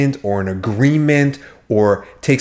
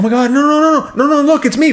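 high, loud panicked reassurance; mock-panicked husband, pitched way up; frantic husband; 4 dB louder, much faster than usual. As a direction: play the husband rushing to calm her. Husband frantically reassuring terrified wife.

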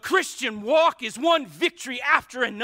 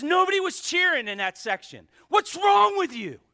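higher, louder fan yelling at the crowd; reliving a stadium rant; retold yell; 8 semitones higher, 5 dB louder than usual. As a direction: bark it at the silent section. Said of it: "But I'm yelling at the Dolphins".

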